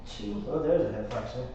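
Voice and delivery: breathless counting through effort; strained, winded counting; out of breath. Real, not acted. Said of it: "I'm out of breath".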